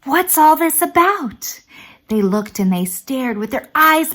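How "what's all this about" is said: crowd shouting. Acted. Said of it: "the people came shouting".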